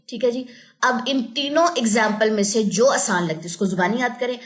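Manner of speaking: usual speaking voice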